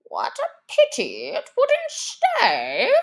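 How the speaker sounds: high, slow, wistful sighing; sighing Lory. Acted. Lory sighing after the departed mouse, high and slow. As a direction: let it out as a sorry sigh. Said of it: "sighed the Lory".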